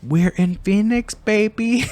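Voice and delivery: high, slow sing-song gloat; gleeful teasing. Real, not acted. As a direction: draw it out to tease. Repeated gleeful taunt.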